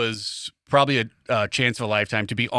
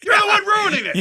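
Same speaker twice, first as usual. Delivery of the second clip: mock shout; 4 dB louder than usual.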